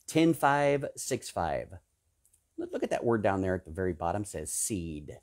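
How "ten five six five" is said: very slow, spaced ten five six five; slow deliberate counting. Shown as a demonstration. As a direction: count out each number with weight. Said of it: "spelling out the divine number".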